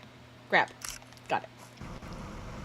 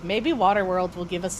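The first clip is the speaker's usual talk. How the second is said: ordinary talk, nothing marked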